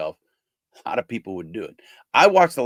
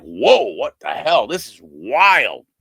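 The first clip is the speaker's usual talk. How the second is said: stunned exclamation; 4 semitones higher, 7 dB louder, slower than usual. Retold or imitated. Stunned reaction to death threat.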